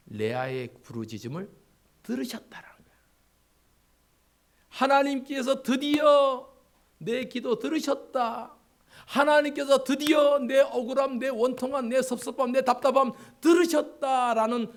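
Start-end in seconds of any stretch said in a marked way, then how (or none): 2.0-3.0 whisper. Acted. Hushed emphasis in sermon. 4.7-14.7 high, loud and slow proclamation; fervent preaching climax. Acted. Pastor proclaiming God heard Leah's cry.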